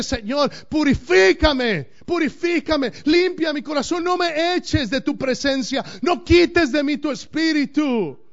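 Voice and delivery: high, loud, slow and half-sung pleading; David's anguished cry. Retold or imitated. Pastor voices David crying out in Psalm 51, anguished sung plea. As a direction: plead like a broken king. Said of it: "es David clamando".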